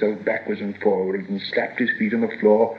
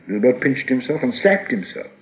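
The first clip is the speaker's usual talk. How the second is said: ordinary delivery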